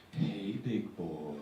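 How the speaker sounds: slow, low, drawn-out seductive purr; hammed-up come-on; mock sexy voice. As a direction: imitate a sultry woman in an ad, stretching each word. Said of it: "with this, like, sexy voice".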